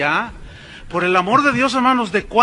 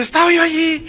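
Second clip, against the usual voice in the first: higher-pitched, mocking testimony voice; mock young woman narrating; 5 semitones higher than usual. Pastor mimics her meeting a woman in hell, mock-dramatic. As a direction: imitate a girl telling her hell vision. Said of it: "aparentemente es una joven narrando".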